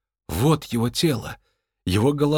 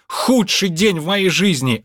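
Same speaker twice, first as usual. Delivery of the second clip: high-pitched, bitter complaint; aggrieved outburst; 8 semitones higher, 3 dB louder than usual. Acted. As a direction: moan it high, utterly fed up. Miserable soul answers the doctor. (с горькой досадой)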